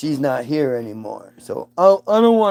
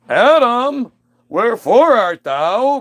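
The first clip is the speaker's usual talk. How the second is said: put-on high, loud, slow old-English call; booming God; 8 semitones higher, 5 dB louder, slower than usual. God coming home calling for Adam, loud theatrical.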